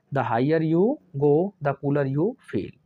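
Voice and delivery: each word slow and spaced for copying; slow dictation. Shown as a demonstration. Teacher dictating an example sentence, slow and deliberate. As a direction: dictate it slowly so the class can write.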